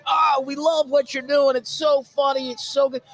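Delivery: high, loud, slow mock gushing; gushing YouTube staff. Mocking YouTube reps who praised his videos, hammed-up gushing. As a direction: ham up fake sweet praise. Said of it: "they were all like".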